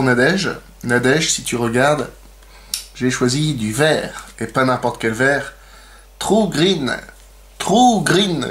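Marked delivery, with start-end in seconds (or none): 6.2-8.5 showy English accent, higher pitch; mock English accent. Shown as a demonstration. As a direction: show off your English accent on "True Green". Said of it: "j'ai un super accent". (accent anglais exagéré)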